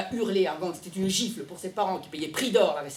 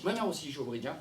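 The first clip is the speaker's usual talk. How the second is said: ordinary delivery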